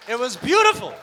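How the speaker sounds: loud insistence on beautiful; crowd-winning shout; emphatic shout. Real, not acted. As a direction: shout it to convince them.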